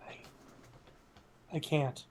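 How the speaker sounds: shaky, trembling and fading to soft; quavering like she's about to break; shivering, quivering voice. Acted. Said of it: "you hear a shivering, quivering voice".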